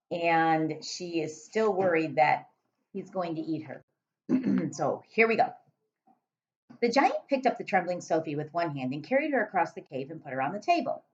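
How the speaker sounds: usual speaking voice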